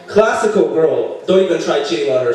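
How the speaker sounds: ordinary talk, nothing marked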